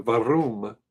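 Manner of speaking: Warum with a German v and trilled r. Shown as a demonstration. Trying to roll the r in warum again. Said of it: "can't even roll my tongue".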